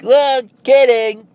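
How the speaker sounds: high, loud, slow sing-song "kidding"; mock kidding. Prankster to friend, high sing-song reveal.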